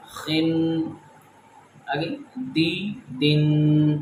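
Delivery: بی then بن, adding the final n; slow contrast drill. Shown as a demonstration. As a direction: slowly set each i against in. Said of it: "دو زیر میں فرق کرتے ہیں".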